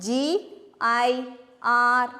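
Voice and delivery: usual speaking voice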